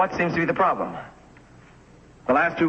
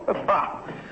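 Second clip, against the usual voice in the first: scoffing laugh; 4 semitones higher, 4 dB quieter than usual. Acted. Scoffs at being dropped.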